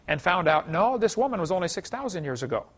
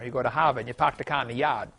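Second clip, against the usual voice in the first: put-on r-less Maine accent, "car" as "cah" and "yard" as "yahd"; 4 semitones lower than usual. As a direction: drop every r like a New Englander. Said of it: "of a strong Maine accent".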